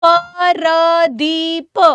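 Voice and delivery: Pradeep stretched very slowly into "पर-दी-प"; slowly sounded out. Shown as a demonstration. Teacher showing pupils how to split Pradeep, slowly sounded out. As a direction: drag out each syllable of Pardeep. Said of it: "धीरे से बोलो प्रदीप".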